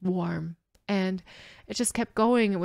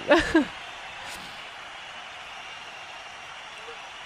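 breathless, airy disbelieving laugh; stunned laugh; 8 semitones higher, 4 dB quieter than usual. Real, not acted. Reacting to the song's end, a breathy laugh. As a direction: let out a soft, stunned laugh. Stunned after the song ends.

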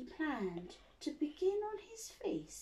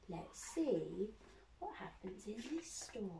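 very soft, dropping toward a whisper; hushed anticipation; 9 dB quieter than usual. Acted. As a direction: hush it right down to open a storybook. Hushed lead-in before opening book.

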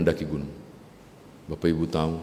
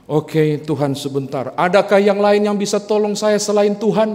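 loud, high frantic plea; panicked shout; 11 semitones higher, 8 dB louder than usual. Acted. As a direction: shout desperately for anyone else. Climber panics, asks for others. (teriakan panik mencari pertolongan lain)

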